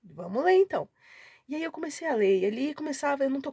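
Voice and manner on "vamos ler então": happy, louder exclamation with swooping pitch; retold delight. Retelling her happy reaction to the Bible title, delighted. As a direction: act out being pleasantly surprised. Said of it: "Fiquei feliz".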